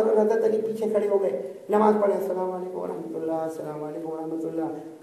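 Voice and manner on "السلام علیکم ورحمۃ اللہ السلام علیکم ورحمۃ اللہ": soft prayer salutation. Acted. Acting out salutation ending prayer.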